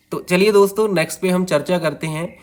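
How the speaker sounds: ordinary talk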